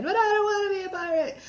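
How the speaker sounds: high, fast, whiny protest; Seinfeld line. Retold or imitated. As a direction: squeal it like a sitcom whine. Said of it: "he did the Seinfeld line".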